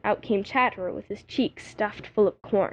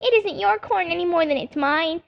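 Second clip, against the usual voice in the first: higher angry bird cry; screaming jay; 6 semitones higher than usual. Acted. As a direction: snap back at the squirrel. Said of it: "screamed Sammy".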